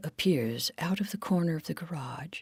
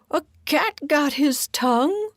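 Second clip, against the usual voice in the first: high, loud rising question; baffled, pitched-up query; incredulous Giddle; 10 semitones higher, 6 dB louder than usual. Acted. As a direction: voice shooting up in disbelief. Giddle asks in disbelief.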